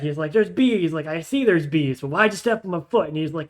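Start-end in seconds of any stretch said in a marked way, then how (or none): 0.3-0.9 louder and higher, frantic; panicky goblin. Acted. Panicked goblin yelling about bees to his neighbour. Goblins bicker after foot stepped. 1.1-3.1 louder and fast, fed up; exasperated goblin. Acted. Goblin demanding why his foot got stepped on, exasperated.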